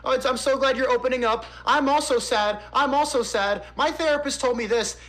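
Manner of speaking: high, gushing mock sympathy; mock sympathetic commenter. Streamer parodying liberal commenters, high gushing mock sympathy. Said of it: "liberals will all go in the comments and be like".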